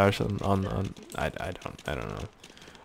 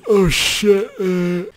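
words stretched by a high yawn; 9 semitones higher, 4 dB louder, much slower than usual. Real, not acted. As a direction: talk straight through a real yawn. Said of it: "Sorry, I was yawning".

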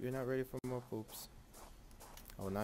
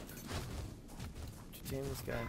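slow breathy whisper under the music; 1 whispered aside; 5 dB quieter, much slower than usual. Real, not acted. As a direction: breathe the question almost silently.

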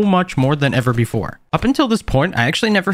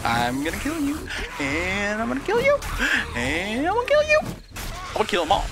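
each threat pitched higher than the last; mock threat; 8 semitones higher than usual. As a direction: climb higher with every kill you. Threatening zombies, voice climbing.

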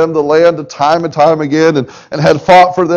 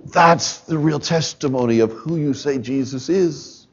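hushed closing appeal; 2 semitones lower, 4 dB quieter than usual. Acted. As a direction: bring the room in close and quiet.

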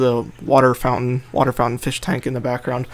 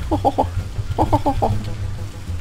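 high breathy giggle; 11 semitones higher than usual. Acted. Laughs after cooing.

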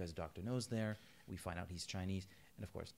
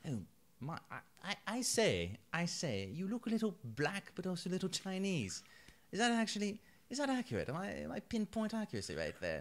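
mock upper-class Englishman, pitched higher; Fleming impression; 9 semitones higher, 3 dB louder than usual. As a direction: ham up a fussy posh gentleman. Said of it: "he's like".

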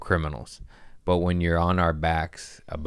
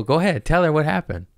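urging; 8 semitones higher, 3 dB louder, faster than usual. Retold or imitated. Dad urging daughter.

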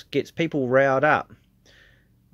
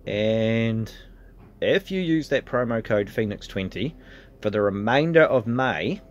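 ordinary talk, nothing marked

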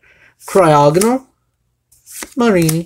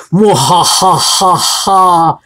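evil cackle; much slower than usual. Acted. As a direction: cackle like a villain over his prize. Gloating over a found card.

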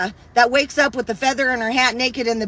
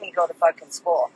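lower, softer, no-nonsense aunt laying down the law; stern aunt; 7 semitones lower, 3 dB quieter than usual. Retold or imitated. Aunt telling the kid she's going to school, stern and low. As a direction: voice the aunt who didn't play, flat firm order.

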